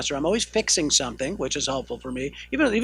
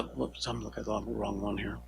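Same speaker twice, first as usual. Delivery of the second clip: much softer, lower, to oneself; muttered aside; 6 semitones lower, 14 dB quieter than usual. Real, not acted. Lecturer checking the wrong slide, muttered aside. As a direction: mumble a distracted self-correction under your breath.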